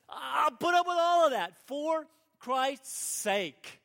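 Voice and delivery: high, loud and slow vow of endurance; fervent declaration. Acted. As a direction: proclaim it, slow and strong. Paul's vow voiced at full force.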